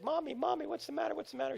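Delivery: put-on high, soft child's voice; a two-year-old pleading with mom; small child asking. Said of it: "I said".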